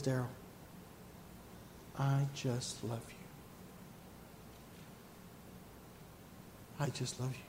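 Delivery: soft, hushed voice of God; hushed and tender. Acted. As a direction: say it gently, like a loving father. Voicing God's tender words.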